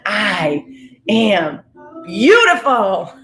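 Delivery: slow, heartfelt affirmation, swelling on the last word; with feeling and conviction. Shown as a demonstration. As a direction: declare it with conviction, stretching the final word. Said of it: "you say it with feeling".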